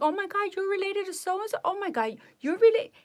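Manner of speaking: high-pitched mocking nurse impression; hammed-up squeal of discovery; stupid nurse. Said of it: "The stupid nurse was like that".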